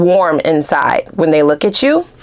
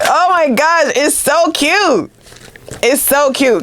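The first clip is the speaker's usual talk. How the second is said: gushing; 7 semitones higher, slower than usual. Real, not acted. Gushing over a sweet card.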